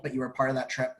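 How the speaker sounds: usual speaking voice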